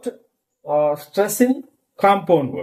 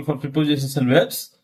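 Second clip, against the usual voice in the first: usual speaking voice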